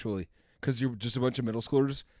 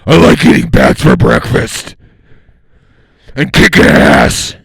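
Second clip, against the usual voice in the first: loud, slow gravelly Batman; 22 dB louder, slower than usual. Acted. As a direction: growl it out like Batman. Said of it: "I've got Batman".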